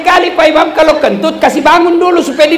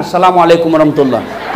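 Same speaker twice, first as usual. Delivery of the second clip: lightly voiced prayer greeting; 10 semitones lower than usual.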